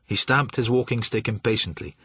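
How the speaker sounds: ordinary delivery, nothing marked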